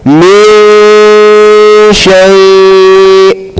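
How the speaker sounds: Min shay chanted slowly, the n of Min a long nasal hum; noon hidden. Shown as a demonstration. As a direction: recite Min shay, hiding the n before sh. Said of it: "just recite again".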